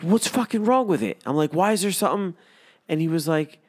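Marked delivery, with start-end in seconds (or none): 0.0-2.3 much higher, louder alarmed asking; panicked questions. Retold or imitated. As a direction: sound rattled and on edge. Retelling his panicked questions.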